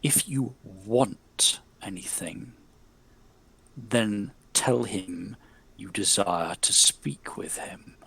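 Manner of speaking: slow, low, soft otherworldly murmur; honeyed spirit voice. Acted. Spirit questioning the player, slow honeyed murmur. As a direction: drip each word out like honey. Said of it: "the spirit says".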